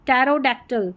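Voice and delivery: ordinary talk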